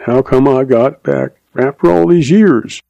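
usual speaking voice

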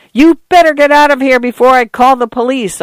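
high, agitated warning yell; shrill, frightened threat, held back in volume. A narrator's reading. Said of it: "Arthur yelled. His voice was shrill".

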